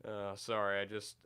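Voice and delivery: usual speaking voice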